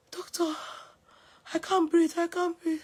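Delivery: faint, feeble, nearly voiceless; weak faint hospital plea; weak breathless plea. Retold or imitated. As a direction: barely get the words out, drained. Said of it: "I didn't have strength".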